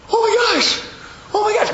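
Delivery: very high, loud imitation of an excited scream; hammed-up shriek of disbelief; mock screaming. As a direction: squeal it like someone who just won big. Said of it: "he started screaming".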